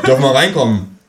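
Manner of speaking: a hint of a pushy policeman; police officer. Police at the door asking to come in, lightly voiced officer.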